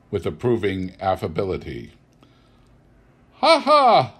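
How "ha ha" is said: loud, high burst of hearty laughter. A narrator's reading. Nephew bursting into hearty laughter at a party. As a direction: laugh out big and free. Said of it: "laughed Scrooge's nephew".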